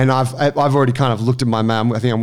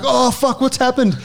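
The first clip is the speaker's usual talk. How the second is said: much higher, startled mock panic; imitating panicked shock; 12 semitones higher than usual. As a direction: squeak it out like a guy who just saw his buddy drop. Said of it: "he's like".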